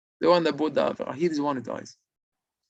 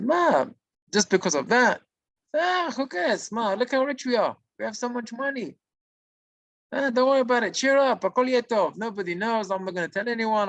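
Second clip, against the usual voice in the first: higher-pitched, breezy brush-off; dismissive reassurance; 8 semitones higher than usual. Retold or imitated. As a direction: play the partner lightly waving the worry away. Partner brushes off the confessed guilt.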